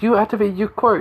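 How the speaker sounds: put-on high-pitched, astonished girl character; acted amazement. Acting girl's amazement at rescuer.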